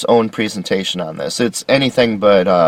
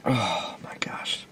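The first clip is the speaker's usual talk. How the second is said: breathy whispered aside; real tired whisper to yourself; 2 semitones lower, 15 dB quieter, slower than usual. Real, not acted. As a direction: mutter it under your breath. Cold, coughing, aside to self.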